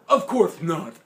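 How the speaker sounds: put-on high, loud old man retort; indignant grandpa. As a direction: snap back as grandpa. Grandpa voiced.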